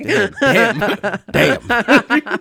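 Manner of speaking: loud, high laughing damns. Real, not acted. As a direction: laugh it out in shock. Laughing shock at a dark joke.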